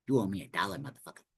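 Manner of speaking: hushed, soft mocking boxer voice; quiet, teasing Tyson send-up; Mike Tyson impression. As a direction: whisper the debt like a taunting champ. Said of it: "Yes, I'm making fun of your voice".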